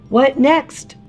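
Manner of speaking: plain reading voice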